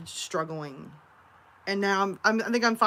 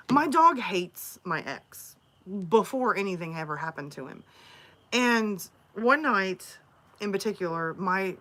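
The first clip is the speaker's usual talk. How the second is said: ordinary talk, nothing marked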